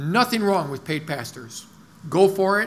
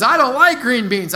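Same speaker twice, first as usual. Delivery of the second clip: higher, louder imitated protest; Isaiah complaining; 7 semitones higher, 8 dB louder than usual. Pastor quoting Isaiah on disliked food, raised mock protest. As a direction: mimic a picky eater objecting loudly. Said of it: "but Isaiah says".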